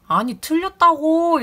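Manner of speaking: the customer refusing to back down, loudly yelling; angry shout. Retold or imitated. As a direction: drag the words out, yelling him down. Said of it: "막 큰 소리를 치기 시작하는 거예요".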